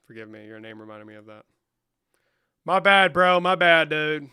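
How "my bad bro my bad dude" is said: loud apology. Real, not acted. Shouted apology on stream.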